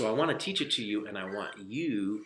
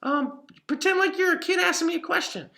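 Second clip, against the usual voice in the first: higher, cheery cartoon cat voice; Pippin the cat puppet; 8 dB louder than usual. Puppet cat answering the author for the kids, higher and cheery. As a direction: chirp it up high like a friendly puppet cat. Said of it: "right, Pip?".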